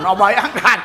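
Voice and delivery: ordinary delivery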